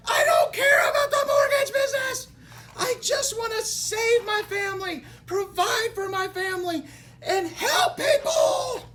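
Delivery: high, loud crying scream, slowing at the end; desperate reenacted sobbing yell; fake scream. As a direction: scream it out through tears. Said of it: "Did you catch that in my fake scream?".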